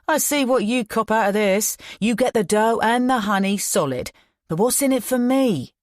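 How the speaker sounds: lightly voiced thug, mocking; a hint of a sneering gangster; Todd's taunt. A narrator's reading. Todd mocking Ian across his desk, lightly taunting.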